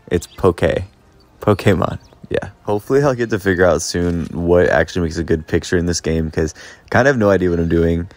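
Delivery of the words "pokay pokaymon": "Poke, Pokemon", an ay vowel after the k; said as po-KAY. Shown as a demonstration. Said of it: "Just like [name]".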